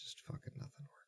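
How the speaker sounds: defeated whisper under the breath; a despairing whisper; frustrated whisper. Real, not acted. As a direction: whisper it, beaten. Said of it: "unvoiced frustrated whisper".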